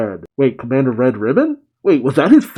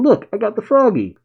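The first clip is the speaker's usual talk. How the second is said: put-on much higher, childlike glee; excited kid character; 9 semitones higher, faster than usual. Pan shows Piccolo the frog she caught, gleeful. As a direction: proudly show off your catch.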